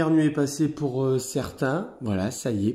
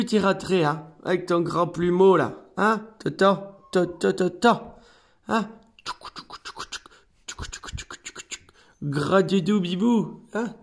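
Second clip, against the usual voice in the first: talking to a cat; 5 semitones higher than usual. Real, not acted. Cooing at cat in hole.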